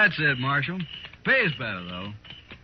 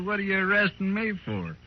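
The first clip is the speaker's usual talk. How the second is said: usual speaking voice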